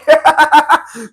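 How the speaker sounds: loud high burst of laughter; can't hold the laugh in; real laugh in the middle of the sign-off. Real, not acted.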